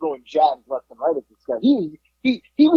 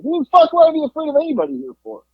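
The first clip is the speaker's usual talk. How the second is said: defiant retort; 12 semitones higher than usual. Retold or imitated.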